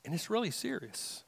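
quiet gravity before the repeat; hushed. Acted. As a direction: drop to a near hush on really serious. Quiet line before emphatic repeat.

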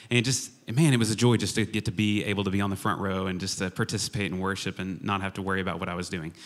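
ordinary delivery

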